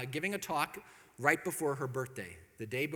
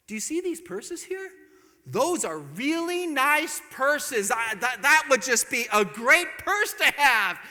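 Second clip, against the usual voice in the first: higher, slow, sing-song hint; hinting wife; 9 semitones higher, 9 dB louder, slower than usual. Retold or imitated. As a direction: drop a big sweet hint. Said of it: "She goes".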